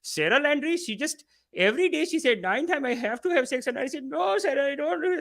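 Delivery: high mock Indian accent; mocking a YouTuber's put-on Indian accent; fake Indian accent. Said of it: "putting on a fake accent".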